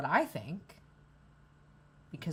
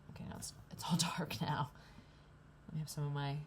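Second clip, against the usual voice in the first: whisper; 2 semitones lower, 6 dB quieter, faster than usual. Real, not acted. Whispering to camera as lights drop.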